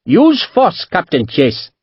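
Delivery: high, grand merchant's retort. A narrator's reading. Merchant answering the captain's threat, grandly. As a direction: throw it back with dignity. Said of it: "Panjit answered just as grandly".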